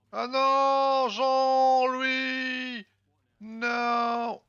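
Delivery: high, loud cry of dismay; dismayed shout. Real, not acted. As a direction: shout out in alarm. Units dying, cries out.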